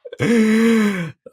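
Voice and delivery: long, high laugh; real laughter. Real, not acted. Guest amused by host's story of impressions, real laughter. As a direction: burst into a high, sustained laugh.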